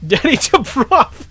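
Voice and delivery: loud, slow, laughing yell; laughing shout. Real, not acted. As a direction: burst out laughing.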